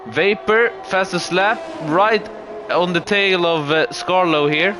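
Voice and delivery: lively play-by-play over the race; motorsport commentator. Acted.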